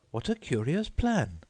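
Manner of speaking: put-on high surprised girl; exclaimed. A narrator's reading. Alice exclaiming at the lesson plan, high voice. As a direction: marvel at it. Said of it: "exclaimed Alice".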